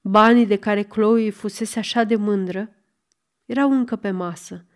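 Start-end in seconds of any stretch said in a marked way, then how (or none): none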